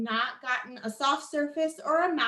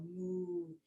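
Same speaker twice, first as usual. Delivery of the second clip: soft moo; 5 semitones higher, 14 dB quieter than usual. Shown as a demonstration. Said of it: "for cow".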